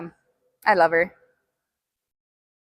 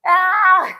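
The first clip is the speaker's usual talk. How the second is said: loud high cry of pain; 5 semitones higher, 5 dB louder than usual. Real, not acted. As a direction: yelp from the muscle burn. Muscles burning after set.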